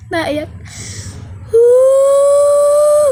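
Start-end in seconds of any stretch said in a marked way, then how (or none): 1.5-3.1 high, loud, long ghostly wail; ghost woo. Pretend. Prankster hiding as a ghost wails at the kid, high and loud.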